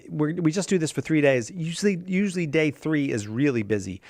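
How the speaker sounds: ordinary delivery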